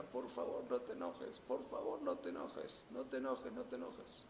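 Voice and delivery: soft whisper begging him to calm down; whispered pleading. Acted. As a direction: hush him gently, almost no voice. Bystander pleading calm to angry man. (súplica susurrada)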